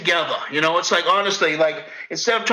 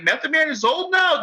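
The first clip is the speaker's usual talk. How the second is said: incredulous; 11 semitones higher than usual. Retold or imitated. Acts out his disbelief at news.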